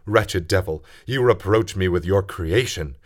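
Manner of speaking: furious defiance. Acted. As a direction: hurl the curse at the monster. Frankenstein rages at the creature.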